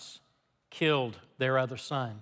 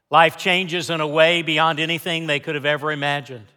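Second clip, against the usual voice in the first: normal talking voice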